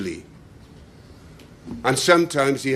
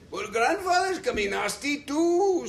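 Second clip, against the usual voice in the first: character voice; 14 semitones higher than usual. A narrator's reading. Character voiced in story reading.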